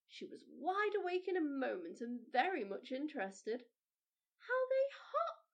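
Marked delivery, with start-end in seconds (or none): 4.4-5.4 put-on high, slow, delighted child's cry; a small girl marvelling at popcorn; little girl crying out in wonder; excited little girl. A narrator's reading. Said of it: "she cried".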